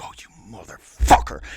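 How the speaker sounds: low, seething swear under a rival's nose; mock seething curse. Host cursing the rival who undercut him, mock seething.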